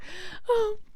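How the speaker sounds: retold gasp. Retelling reading the love messages.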